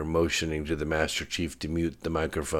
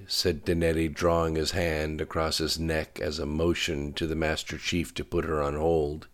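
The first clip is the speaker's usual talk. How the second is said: plain reading voice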